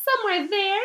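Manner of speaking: put-on high airy ghost-girl voice, dreamy; ghostly girl. Ghost girl tells Harry of her death, high and eerie.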